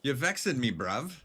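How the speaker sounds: put-on echoing the game's British line in a London accent; mock London accent, stress on vexing; British accent imitation. Streamer echoing game character in mock London accent. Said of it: "is that like the Manchester accent or something".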